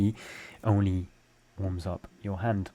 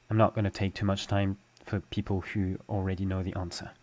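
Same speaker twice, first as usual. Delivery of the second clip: normal talking voice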